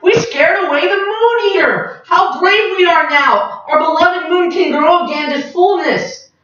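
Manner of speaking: high, proud cheering voices; triumphant creatures. Acted. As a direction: celebrate the win. Creatures celebrate victory.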